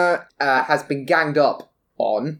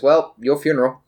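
offhand in-character dismissal; mildly unconcerned warning; shrugging; faster than usual. Acted. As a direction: say it with a verbal shrug. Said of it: "just shrugs and goes".

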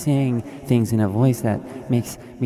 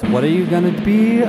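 guru hype climax; 10 semitones higher, 5 dB louder than usual. Acted. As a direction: stretch best success like a stadium chant.

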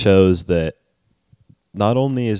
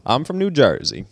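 higher-pitched mock New Jersey accent; 7 semitones higher than usual. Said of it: "to do a Jersey accent".

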